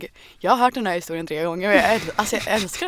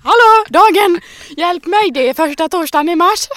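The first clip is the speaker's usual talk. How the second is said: much higher and louder, gleeful; excited outburst; 9 semitones higher, 9 dB louder than usual. Real, not acted. Host bursts out announcing the odd holiday, laughing excited. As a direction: shout it like a hyped holiday.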